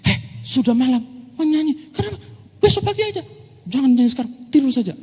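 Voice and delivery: put-on grandma telling grandson to sleep, high old-lady voice; grandma hushing. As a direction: shush the child back to sleep.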